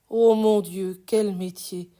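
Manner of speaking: loud, exclaimed lament in a poem; poetic lament. Acted. Reciter lamenting the peasants' hard trade, loud exclaimed lament. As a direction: cry out the exclamation, then ease off.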